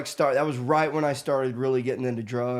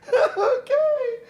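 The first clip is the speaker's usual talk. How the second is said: high, laughing okay; squeaky laughing surrender; 4 dB louder than usual. Retold or imitated. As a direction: give in through a laugh. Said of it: "I just was like".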